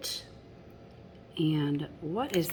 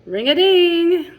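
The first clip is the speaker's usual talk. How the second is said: much higher, much louder ring-a-ding; big excited shout; 11 semitones higher, 14 dB louder than usual. Acted. Reader to children, bell ringing loudest, excited shout. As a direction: belt it out like a clanging bell.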